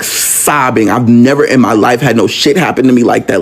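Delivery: normal talking voice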